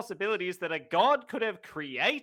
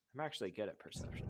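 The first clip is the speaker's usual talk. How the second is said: muttered low aside; quiet aside; 11 semitones lower, 16 dB quieter, much faster than usual. Acted. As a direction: say it under your breath. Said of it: "I say as I roll".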